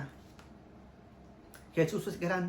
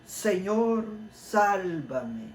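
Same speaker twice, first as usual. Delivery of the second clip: high, loud cry of Señor; preacher's cry; 5 semitones higher, 4 dB louder, much slower than usual. Retold or imitated. Preacher acts out Pedro crying out, a loud plea. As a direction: shout it like Pedro sinking in the sea. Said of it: "Gritó".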